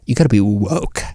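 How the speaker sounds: low put-on British voice; mock British accent. Shown as a demonstration. Host showing how to say woke, mock British accent. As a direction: sink into a fake British "woke". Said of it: "that's the way you gotta say it".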